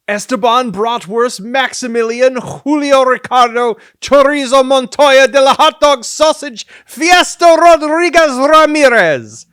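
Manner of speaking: high, loud, building name proclamation; grand mock announcement. Reading out a silly sausage name, grandly over-the-top.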